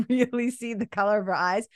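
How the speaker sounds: ordinary talk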